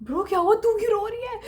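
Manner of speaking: turning round, alarmed at the tears; startled concern. Retold or imitated. Asking girl behind why crying.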